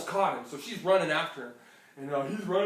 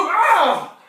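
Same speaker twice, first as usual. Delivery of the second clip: pained yelp; 13 semitones higher, 17 dB louder than usual. Acted. Rabbit hit by purse.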